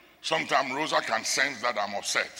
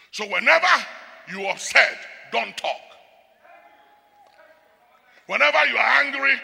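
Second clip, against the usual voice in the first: loud, ringing pulpit warning; full-voiced sermon delivery; preaching at full force; 8 semitones higher, 7 dB louder than usual. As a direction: thunder it at the congregation like a preacher. Preaching hard against loose talk.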